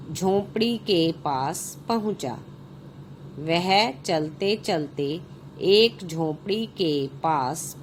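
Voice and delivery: put-on slow, clear reading for children; story narration. A narrator's reading. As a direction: read each phrase out slowly to the class. Reads the story aloud. (बच्चों के लिए कहानी पढ़ना)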